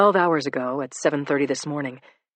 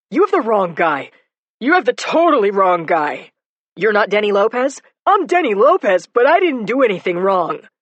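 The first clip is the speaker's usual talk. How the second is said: raised, loud denial; loud protest; 5 semitones higher, 8 dB louder than usual. Acted. Suspect protesting to the arresting cop, loud denial. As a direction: protest your innocence loudly.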